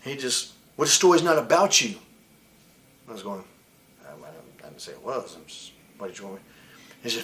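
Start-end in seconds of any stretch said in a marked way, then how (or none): 0.8-2.0 loud, dismissive snap; curt retort. Retold or imitated. Sean snapping back at the wrestler, loud and dismissive. Retelling Sean's dismissive reply. 4.0-6.4 much softer, hurried and deflated; meek reply. Retold or imitated. Wrestler answering Sean meekly, soft and rushed.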